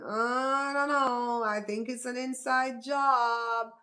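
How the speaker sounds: high, very slow mock hesitation. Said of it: "Even he now is saying".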